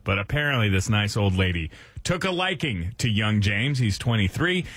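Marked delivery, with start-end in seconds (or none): none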